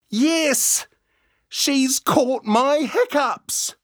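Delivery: high delighted shout; excited laughing cry. Acted. Manu laughing at goat.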